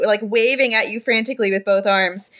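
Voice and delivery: ordinary talk, nothing marked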